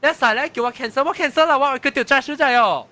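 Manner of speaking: high, loud, agitated complaint; worked-up retelling. Retold or imitated. Passenger recounting being told to cancel the Uber, worked-up. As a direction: protest loudly, pitch jumping well up.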